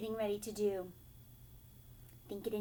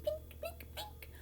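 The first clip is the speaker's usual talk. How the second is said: very high plinks, fading; string snapping sounds; 9 dB quieter than usual. Acted. Narrator imitating harp strings snapping, very high plinks. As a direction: pluck each word like a breaking string.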